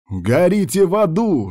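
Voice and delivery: drawn-out high battle yell; yelling at full pelt. A narrator's reading. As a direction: roar it out slowly at the enemy. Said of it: "что есть мочи, орал Артур".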